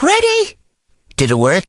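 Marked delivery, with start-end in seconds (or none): 0.0-0.6 very high squeaky crack; deliberate voice crack. Shown as a demonstration. Testing a voice changer with a deliberate voice crack. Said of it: "try to make it have a voice crack".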